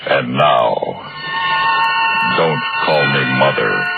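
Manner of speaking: ordinary talk, nothing marked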